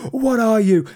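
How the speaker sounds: high, loud mock chef yell; hammed-up chef demanding an answer; Gordon Ramsay impression. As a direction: bark it at the hapless cook. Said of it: "a Gordon Ramsay voice".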